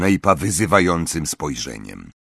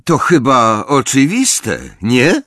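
slow, raised, high call; eager higher-pitched exclamation; 5 semitones higher, 5 dB louder, slower than usual. A narrator's reading. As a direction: blurt it out loud and drawn out. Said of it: "zawołał Snape".

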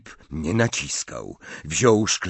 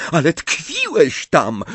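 higher, louder accusation; insistent cry; 10 semitones higher, 6 dB louder than usual. Acted. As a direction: throw it at him. Bellatrix insists.

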